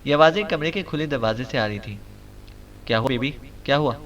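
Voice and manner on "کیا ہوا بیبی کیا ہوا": flustered. A narrator's reading. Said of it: "کھلائے انداز میں کہا".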